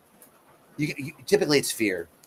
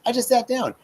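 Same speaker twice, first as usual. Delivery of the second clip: much higher, lightly voiced kid; five-year-old inner voice; 8 semitones higher than usual. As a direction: whine it like a small child defending himself. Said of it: "checking in with myself and going".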